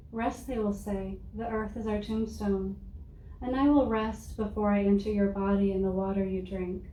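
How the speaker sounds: ordinary delivery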